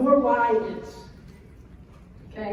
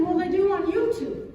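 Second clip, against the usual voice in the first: put-on higher child insistence; whiny kid; 7 semitones higher than usual. Son repeating his YouTube claim, higher. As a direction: repeat it like a stubborn boy. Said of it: "my son says to me".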